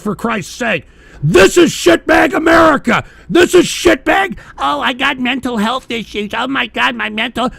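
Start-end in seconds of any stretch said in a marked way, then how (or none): 1.2-4.4 slowed, much higher and louder angry shout; furious yell. Real, not acted. Ranting about America, yelling. 4.6-7.5 a higher, whining parody of someone moaning about their issues; mocking whiny voice. Mocks people with mental health issues.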